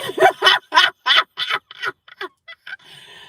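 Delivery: loud squealing laughter; high shrieking laugh. Real, not acted. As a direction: burst out in high giddy laughter. Big laugh.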